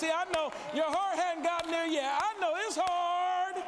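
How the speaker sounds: loud, driving sermon climax; rally the church to action; preaching at full force. Acted. Preacher rallying the congregation to win the city, full force.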